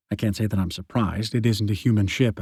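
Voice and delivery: ordinary delivery, nothing marked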